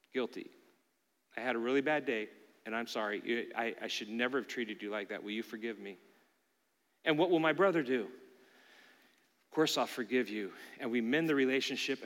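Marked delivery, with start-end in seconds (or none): 0.1-6.0 hushed, humble apology; owning up quietly, head bowed; soft contrite confession. Acted. Pastor voicing his apology to a hurt brother, hushed and contrite. 8.0-10.5 slow, soft, kindly reassurance; gentle forgiveness. Acted. Brother answering the pastor's apology, slow and gentle.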